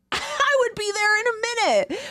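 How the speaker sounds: high-pitched, laughing eagerness; excited squeal. Pretend. Co-host jumping at an imagined smoke break, excited squeal. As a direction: squeal it like you'd jump at the chance.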